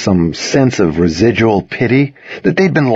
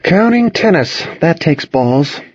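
mild higher-pitched mocking echo; muttered quip; 8 semitones higher, slower than usual. A narrator's reading. Toby joking about his friend's classes, muttering. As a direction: echo "Counting tennis" higher, then slow down for the punchline. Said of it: "Toby muttered".

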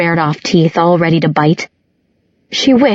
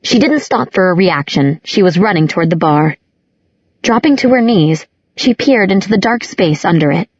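ordinary delivery

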